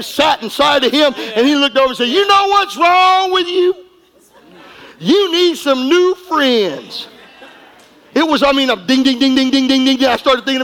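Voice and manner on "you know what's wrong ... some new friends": dad's words shouted, higher, then slowed down; retold Holy Ghost shout. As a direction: shout it out like the Spirit speaking through dad, then drag out the ending. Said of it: "it was as if the Holy Ghost came".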